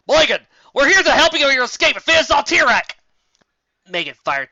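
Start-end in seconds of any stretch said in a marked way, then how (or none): 0.1-2.9 high, loud battle call; shouting. Acted. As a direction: shout over the fighting.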